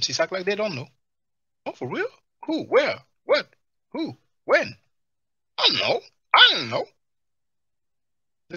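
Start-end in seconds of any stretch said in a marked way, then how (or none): 1.6-4.8 high, loud mock-innocent questions; mock clueless. 5.6-6.9 high, louder mock I-don't-know; mock ignorance. Mocking MCs acting ignorant.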